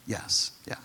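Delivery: a soft whisper of reassurance; whispered reply. Acted. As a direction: breathe the answer gently to him. Jesus answers Peter at footwashing.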